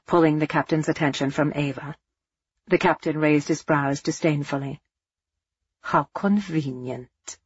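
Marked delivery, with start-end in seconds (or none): 5.8-7.4 slow, dry and sarcastic; dryly. Acted. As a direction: let it drip with dry contempt. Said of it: "von Essen said dryly".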